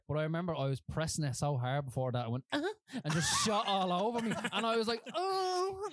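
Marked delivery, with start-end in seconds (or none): 2.5-3.0 high squeak. Retold or imitated. Tuna squirting, retold grunt. 5.1-5.9 shocked high oh. Retold or imitated. Retold reaction to tuna spray.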